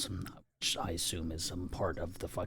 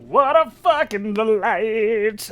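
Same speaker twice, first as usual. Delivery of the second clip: drawn-out, high sarcastic delight; slow and high, over-the-top fake delight; mock delight; 14 semitones higher, 14 dB louder, slower than usual. Real, not acted. Reader mocks the interruption with slow high fake delight. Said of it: "repeats sarcastically".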